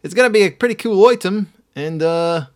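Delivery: jokey high, loud Australian bloke voice; hammed-up Australian mate; Australian accent. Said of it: "That's Australian".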